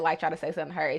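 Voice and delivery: ordinary talk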